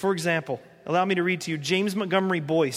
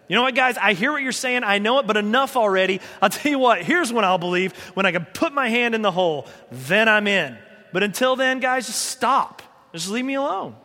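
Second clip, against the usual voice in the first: fed-up outburst; 2 semitones higher, 4 dB louder than usual. Retold or imitated. Thomas snapping at the other disciples, exasperated and loud. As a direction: brush them off, you've had enough.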